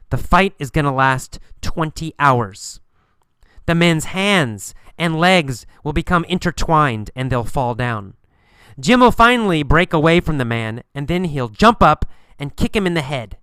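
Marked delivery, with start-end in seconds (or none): none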